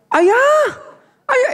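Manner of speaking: high, loud call across the floor; calling out loudly. Retold or imitated. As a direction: yell for dad from afar. Calling husband in the mall.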